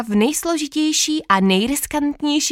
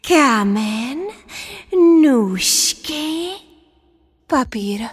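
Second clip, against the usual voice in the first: breathed out; 4 semitones higher, much slower than usual. Acted. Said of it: "vydechla jsem".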